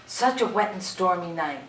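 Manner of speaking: ordinary delivery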